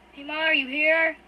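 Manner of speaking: loud, slow call from down the hall. Acted. Brother calling out to find his sibling, loud and slow. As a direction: shout it across the house.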